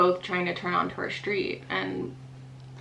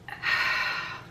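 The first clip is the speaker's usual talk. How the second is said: long wordless exhale, imitating an embarrassed husband; embarrassed sigh. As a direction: sigh as if wishing she would calm down. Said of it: "he was doing one of these".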